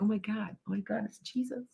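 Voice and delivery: hushed, breathless "oh my God", reliving the shock; imitating her own stunned awe, barely voiced; retold freaking out. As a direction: gasp it out softly as if seeing him again. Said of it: "'cause I'm freaking out".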